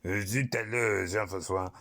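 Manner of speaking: slow, drawn-out fake French; hammy French exclaiming, stretched out; silly French accent. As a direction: put on a badly faked French accent. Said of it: "doing the silly French accent".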